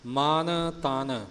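ਮਨ then ਤਨ, each word separate, very slow; words kept apart. Shown as a demonstration. Teacher stressing the separate letters, very slow. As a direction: set ਮਨ and ਤਨ apart with a clear gap. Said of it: "ਮਨ ਤਨ ਵੱਖਰਾ ਵੱਖਰਾ ਬੋਲਣਾ ਹੈ".

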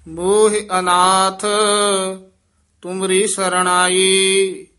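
measured, half-chanted verse reading; chanted Gurbani reading; scripture recitation. Acted. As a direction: recite sacred verse with long held vowels.

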